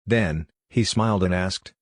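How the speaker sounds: ordinary delivery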